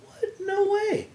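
can't believe it; shocked disbelief. Retold or imitated. Disbelief at mouse claim.

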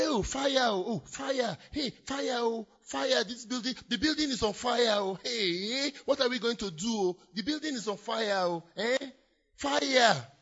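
high, frantic calls of "fire" and "oh"; panicked cries. Acted. Preacher acting a believer fleeing a burning building, frantic. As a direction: run about crying out in alarm.

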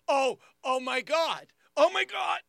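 high, loud acted cries of pain; hammed-up pain attack; mock agony. Brother's pain attack returns, acted.

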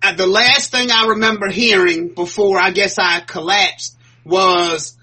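normal talking voice

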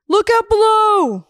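high, loud shout from a window; calling out. Pretend. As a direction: yell it down to the street.

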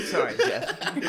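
high, slow, giggling apology; laughing sorry. Real, not acted.

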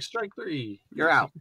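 soft, offhand umpire call; low-key umpire. Retold or imitated. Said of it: "and some are just like".

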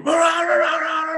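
very high, loud wailing siren noise; high warbling electronic alarm; imitated device alarm. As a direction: mimic an alarm warbling frantically up and down. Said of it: "it just starts going".

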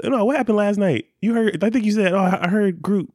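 high-pitched mock questioning; imitating waking questions. Partner retells Randy's waking questions, high mock alarm. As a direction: imitate a partner just woken and full of questions. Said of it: "You'll wake up and start asking".

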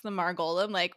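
ordinary delivery

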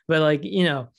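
normal talking voice

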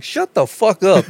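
high, slow, emphatic telling-off; retold angry order. Driver silences the babbling passenger, high retold anger. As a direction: replay the outburst, stretching each word.

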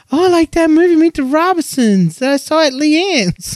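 put-on high, eager voice of a young daughter; little girl voice. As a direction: chirp it like a happy little girl. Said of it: "she was like".

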